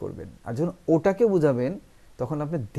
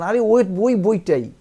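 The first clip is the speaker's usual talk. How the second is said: ওই hit loud and high; high, punched ওই before a drawn-out বইটাই; forceful emphasis; 7 semitones higher, 9 dB louder, slower than usual. Shown as a demonstration. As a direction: insist on ওই বইটাই, slow and loud. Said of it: "জোর দিয়ে বলতেছেন".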